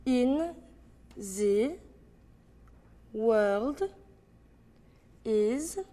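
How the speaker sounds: French accent, one word at a time. Shown as a demonstration. Breaking the line down word by word, French accent. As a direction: separate each word with long gaps, French accent. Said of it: "notice that R".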